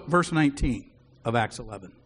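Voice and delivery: ordinary talk, nothing marked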